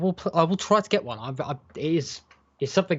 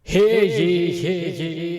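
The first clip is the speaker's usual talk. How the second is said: town crier; 3 semitones higher, 5 dB louder, much slower than usual. Pretend. Mock proclamation opens the show.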